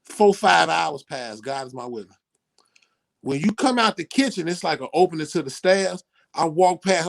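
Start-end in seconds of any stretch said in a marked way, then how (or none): none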